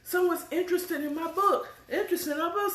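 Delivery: high, bubbly excited squeal; ecstatic. Retold or imitated. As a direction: gush like great news just landed. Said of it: "she's like, ecstatic".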